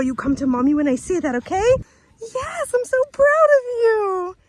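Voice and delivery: very high, slow gushing praise. Real, not acted. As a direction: gush over a good puppy. Praising her dog after recall.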